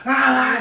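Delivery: loud high half-asleep yell; sudden loud groggy cry; mock yell. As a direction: bellow it at the sister upstairs. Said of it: "I shouted my sister upstairs, like".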